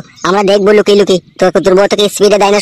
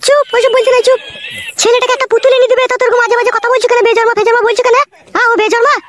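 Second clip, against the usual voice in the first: much higher, shrill-pitched wife voice; scolding mother; 13 semitones higher than usual. Acted. Wife scolding husband over crying son, high-pitched.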